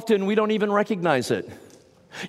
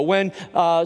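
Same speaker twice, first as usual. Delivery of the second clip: normal talking voice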